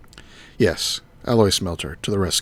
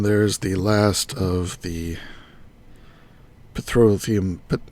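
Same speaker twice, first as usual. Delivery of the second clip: usual speaking voice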